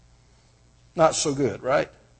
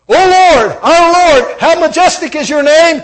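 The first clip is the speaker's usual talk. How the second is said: loud, high triumphant proclamation; exultant preacher crying out scripture; proclaimed psalm; 12 semitones higher, 11 dB louder than usual. Acted. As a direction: ring it out like a psalm of praise.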